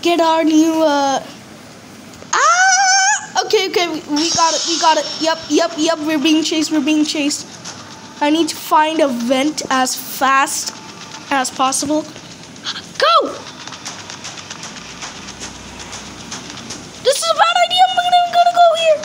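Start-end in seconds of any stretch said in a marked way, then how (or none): 2.3-3.4 sudden high yelp of fright; startled scream. Real, not acted. Startled by the piggy. 12.0-19.0 high, fast panicked babble. Real, not acted. Fleeing the chasing piggy.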